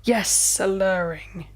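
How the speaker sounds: "alluring," savoured slowly, in a lightly voiced vampire tone; smooth vampire repeat. A narrator's reading. Vampire Alistair savouring the word back to Thea, lightly voiced. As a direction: linger on "alluring" like a seducer. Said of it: "he continued".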